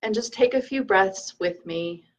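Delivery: calm meditation leader, leaving room to breathe; guided meditation. Acted. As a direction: lead them gently through the breath, unhurried.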